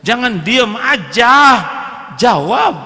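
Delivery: loud, high demand; mock husband barking for a reply. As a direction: press her to answer.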